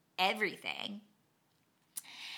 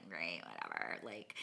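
much lower, softer, resigned; defeated mumble; 12 dB quieter than usual. Retold or imitated. Exchanging details after the crash, low and defeated. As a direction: sink into flat defeat.

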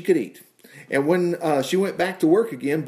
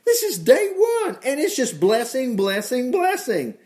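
high-pitched, stretched-out exclamations; excited marvelling; 11 semitones higher than usual. Real, not acted. Preacher marvels to the congregation at day-one blessings, excited.

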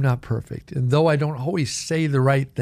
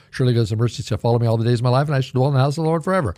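rapid-fire recitation; real fast; much faster than usual. Shown as a demonstration. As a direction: rattle off the Psalm at speed, barely pausing. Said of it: "quote it for you real fast".

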